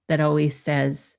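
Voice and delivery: ordinary talk, nothing marked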